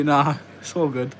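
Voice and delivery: usual speaking voice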